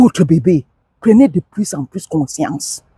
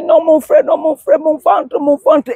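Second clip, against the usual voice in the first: pained husband; 8 semitones higher than usual. Retold or imitated. Husband in pain replies.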